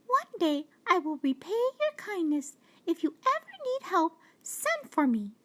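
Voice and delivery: high, tiny mouse voice full of thanks; grateful mouse. Acted. Mouse thanking the lion for sparing her, high and tiny. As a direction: squeak your thanks like a small mouse. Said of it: "said the mouse".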